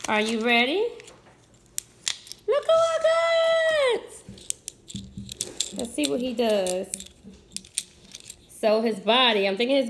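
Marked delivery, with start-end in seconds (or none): 2.5-4.0 excited squeal. Real, not acted. Toy reveal to viewers.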